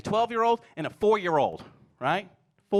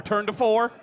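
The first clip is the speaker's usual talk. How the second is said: calling camera cues; 5 semitones higher, 6 dB louder, slower than usual. Retold or imitated. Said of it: "He knew turn to four".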